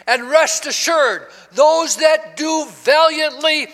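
emphatic, measured sermon delivery; preaching. Acted. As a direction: build to slow, ringing declarations of victory. Preaches to congregation.

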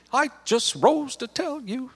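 very high, slow woman's voice; Sister Urshan. Retold or imitated. Church pianist addressing the congregation while rocking, high slow imitation. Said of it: "and she'd say".

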